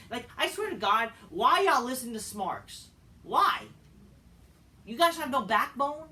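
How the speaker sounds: loud, high-pitched exasperated challenge; exasperated rant. Real, not acted. Ranting at fans who heed Smarks.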